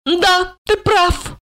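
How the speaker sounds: ordinary delivery, nothing marked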